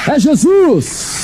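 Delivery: high, fervent preacher build; preaching at full force. Acted. Preacher tells the congregation of the phone call, preaching at full force. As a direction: drive the climax home.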